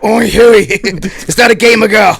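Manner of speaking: high, loud Cockney shout with laughter; Cockney accent. Retold or imitated. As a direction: yell it like a rough Londoner, laughing. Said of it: "I'd be like".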